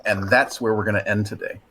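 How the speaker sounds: ordinary delivery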